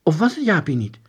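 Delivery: usual speaking voice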